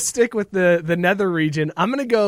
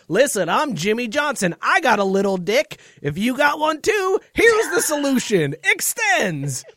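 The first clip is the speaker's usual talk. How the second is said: hammed-up TV pitchman, higher and climbing to a shout; Jimmy Johnson pitchman; 6 semitones higher than usual. Host mocks a late-night pill ad, hammed-up pitchman shout. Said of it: "Pills that he's like".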